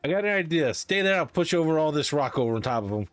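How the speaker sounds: ordinary delivery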